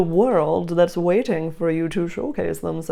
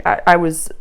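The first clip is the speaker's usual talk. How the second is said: ordinary delivery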